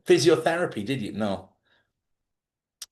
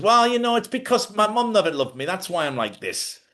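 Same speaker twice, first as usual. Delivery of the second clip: hammed-up whiny excuse; mock excuse; 8 semitones higher than usual. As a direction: mimic someone blaming their mum. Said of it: "it's excuses".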